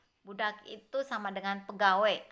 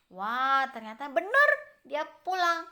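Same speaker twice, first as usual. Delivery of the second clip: ordinary delivery, nothing marked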